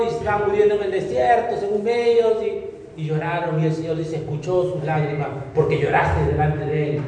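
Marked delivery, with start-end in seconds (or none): none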